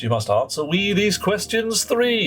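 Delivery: mock gatekeeper. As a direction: pose the three questions grandly. Mock quest challenge to newcomer.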